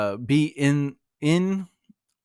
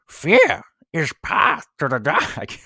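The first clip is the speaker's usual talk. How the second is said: slow, croaky Yoda impression; 4 semitones higher, 5 dB louder, slower than usual. Teacher tries a Yoda voice for students, slow hammed-up impression. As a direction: put on a bad Yoda, slowly. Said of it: "Should I do it in the voice?".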